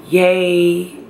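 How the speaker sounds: half-hearted drawn-out cheer; a mild, stretched-out little yay; attempted excitement. Expectant mother trying to get excited, half-hearted cheer. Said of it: "like, get excited".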